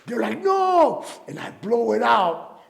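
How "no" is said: high, loud shocked cry; alarmed shout. Retold or imitated. Studio crew shouting as he takes a puff. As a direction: yell it in sudden alarm.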